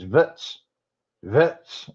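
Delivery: "vits" with the vowel of votes squashed short; mocking mispronunciation. Commentator making fun of this guy, mocking "vits". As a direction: mimic Trump saying votes as "vits". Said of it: "Who the hell says".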